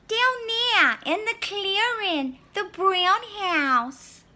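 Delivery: put-on high girl voice, slow at the end; Little Red. Little Red showing the wolf Granny's house, high. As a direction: point the way like an eager little girl.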